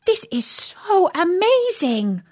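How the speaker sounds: put-on high, thrilled girl voice; amazed. A narrator's reading. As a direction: marvel at being the big sister. Daisy amazed as big sister.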